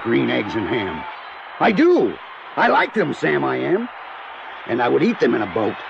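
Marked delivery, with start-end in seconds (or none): 1.6-3.1 high, bright discovery; surprised joy at the taste; delighted surprise. Acted. Grump tasting green eggs, delighted high.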